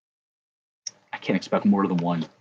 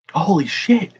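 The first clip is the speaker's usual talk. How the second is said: excited; 9 semitones higher, 6 dB louder, slower than usual. Real, not acted. Pulling a big hit card.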